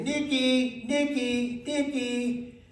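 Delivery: high, carrying call; wife calling the dog. Retold or imitated. Parrot copying the wife calling the dog. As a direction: call the dog's name up high. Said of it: "in my wife's voice and with her tone".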